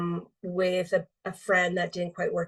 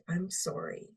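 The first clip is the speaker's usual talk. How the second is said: "I'm sorry" said softly, letting it sink; heavy, quiet apology; 8 dB quieter than usual. Shown as a demonstration. After feeling the word's weight, she says "I'm sorry" softly and heavily. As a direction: let "I'm sorry" drop soft and heavy. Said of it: "It's heavy".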